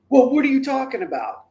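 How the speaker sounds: high, loud imitation of a confused teen; baffled protest. Confused teen questioning father's lecture, baffled.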